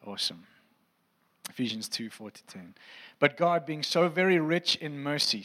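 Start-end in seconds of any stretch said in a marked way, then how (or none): 3.2-5.4 higher, louder preaching read; scripture reading. Acted. Pastor reading Ephesians to congregation, raised preaching voice. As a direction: proclaim the passage to the room. Reads Ephesians aloud to church.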